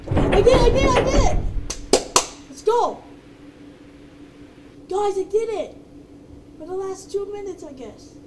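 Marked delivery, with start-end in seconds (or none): 0.1-5.8 high, loud victory yell; triumphant shouting. Real, not acted. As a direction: shout it out like you just won. Winning the escape.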